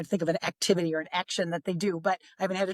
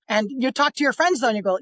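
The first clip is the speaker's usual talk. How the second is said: ordinary delivery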